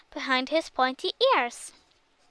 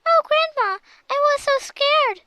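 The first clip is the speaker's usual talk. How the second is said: high, louder tearful cry; a frightened girl sobbing to her grandma; 13 semitones higher, 6 dB louder than usual. A narrator's reading.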